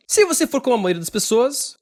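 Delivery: usual speaking voice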